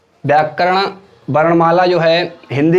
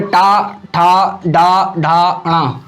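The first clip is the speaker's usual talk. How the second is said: टा ठा डा drawn out slowly; a teacher spelling out टा, ठा, डा; slow syllable demo; much slower than usual. Shown as a demonstration. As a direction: say the retroflex sounds slowly for students. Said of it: "मूर्धा या मूर्धन्य".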